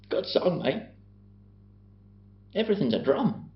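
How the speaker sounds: high-pitched mock Australian; badly faked Australian accent, pitched well up; attempted Aussie accent. Shown as a demonstration. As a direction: do a hammed-up Aussie mate. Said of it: "You any good at an Aussie accent?".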